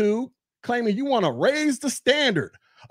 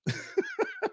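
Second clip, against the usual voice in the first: a sudden high laugh after the jab; real amused laugh; high burst of laughter; 9 dB quieter than usual. Real, not acted. Laughs at own call-out.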